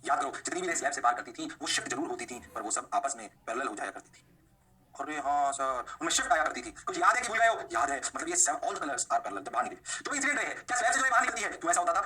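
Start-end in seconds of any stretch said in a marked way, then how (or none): 4.9-5.9 drawn-out lower pupil voice; student realisation. Acted. Teacher playing students recalling the slab, student realisation. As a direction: play a pupil remembering.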